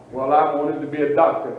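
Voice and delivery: preacher voicing a man who wanted to be a doctor, low; voiced disappointed person. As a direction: answer like a letdown dreamer.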